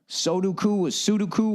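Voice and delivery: Sudoku said two ways, soh-DOO-koo then soo-DOO-koo; two pronunciations. Shown as a demonstration. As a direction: try both ways of saying Sudoku. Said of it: "however the fuck you say that".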